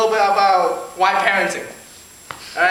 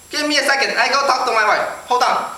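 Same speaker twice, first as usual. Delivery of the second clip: put-on Chinese-accented shopkeeper, pitched up; 4 semitones higher than usual. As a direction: stall the customer in a mock Chinese accent. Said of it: "So he goes".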